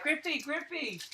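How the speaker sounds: high, eager calling out a name; excited fans calling. Retold or imitated. Young fans calling to their hero at spring training, high and eager. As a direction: imitate kids yelling for a star. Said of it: "We were just so excited to go see him".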